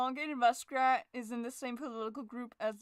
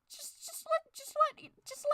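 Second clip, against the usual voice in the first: manic sing-song. Acted. Manic high sing-song bit.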